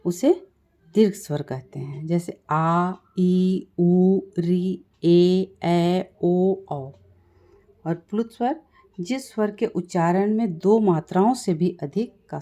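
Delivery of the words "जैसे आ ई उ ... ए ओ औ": उ and री slowly stretched, each vowel held long. Shown as a demonstration. Teacher reciting the long vowels to students, held out. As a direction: give each long vowel double length. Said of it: "से दुगुना समय लगता है".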